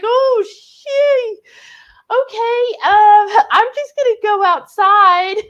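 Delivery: put-on a kid edging out of the room, voice jumped high; high, startled kid voice. Young self fleeing the parents' fight, high and startled. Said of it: "I was like".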